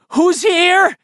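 high, trembling scream of fear; terrified scream. Acted. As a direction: shriek it out, shaking with terror. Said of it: "he screamed in a high, trembling voice".

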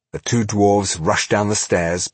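ordinary delivery, nothing marked

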